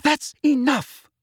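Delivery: high, loud, drawn-out command; slow, high-pitched forceful order; forceful Hermione. A narrator's reading. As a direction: put your foot down forcefully. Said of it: "Hermione said forcefully".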